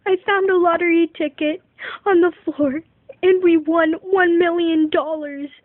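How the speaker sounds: loud, high and fast with joy; overjoyed announcement. Retold or imitated. Mom telling her kids they won the lottery, loud and overjoyed. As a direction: burst out with the big news.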